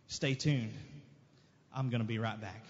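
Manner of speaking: soft, intimate promise; tender reassurance. Acted. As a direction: speak it gently as Jesus to the hurting. Jesus speaking softly to listeners.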